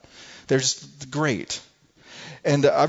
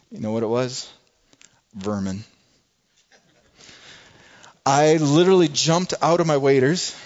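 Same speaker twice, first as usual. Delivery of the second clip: usual speaking voice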